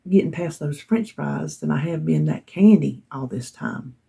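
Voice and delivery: ordinary talk, nothing marked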